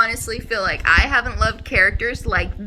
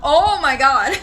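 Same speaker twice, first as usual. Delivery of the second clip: excited gasp of delight, higher pitch; 4 semitones higher, 4 dB louder, slower than usual. Real, not acted. Vlogger seeing their new books, excited. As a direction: burst out, breaking into a laugh. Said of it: "I'm getting excited".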